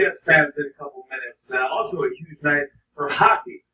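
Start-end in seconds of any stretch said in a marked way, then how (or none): none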